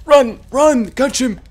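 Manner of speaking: high, loud mimicked cries; mock frantic dragon. Player mocking the crazy old dragon, mock frantic shouting. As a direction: yelp it as a hammed-up panicked old dragon.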